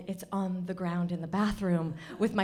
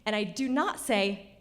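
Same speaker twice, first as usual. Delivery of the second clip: normal talking voice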